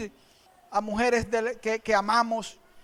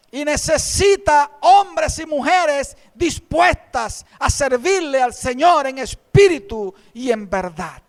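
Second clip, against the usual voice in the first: rallying sermon; 3 semitones higher, 5 dB louder than usual. Acted. Rallying the congregation.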